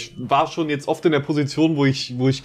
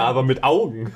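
ordinary talk, nothing marked

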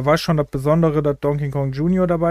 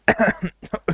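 sudden cough after swallowing wrong; choking cough; 5 semitones higher than usual. Real, not acted. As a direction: cough hard as the drink goes down wrong.

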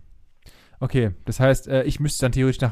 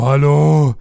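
man doing a woman's voice; 3 semitones higher, 10 dB louder than usual. Pretend. Mocks how the girlfriend sounds.

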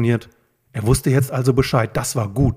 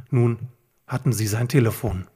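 plain reading voice

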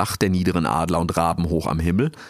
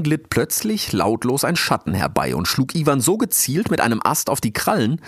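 ordinary delivery, nothing marked

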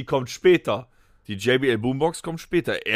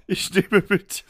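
stifled giggles; 4 semitones higher than usual. Real, not acted. Giggling through own turn.

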